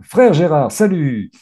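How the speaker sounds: usual speaking voice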